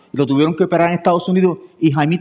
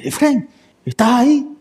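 higher, drawn-out mock calling voice; imitated eager phone greeting; 6 semitones higher than usual. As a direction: play the friend checking in, pitched up and stretched. Imita al amigo llamando por teléfono. (imitando una llamada sorprendida, alargada)

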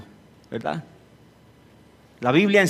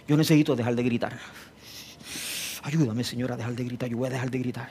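quiet resolve; 6 semitones lower, 9 dB quieter, faster than usual. Acted. Husband vows to stop shouting.

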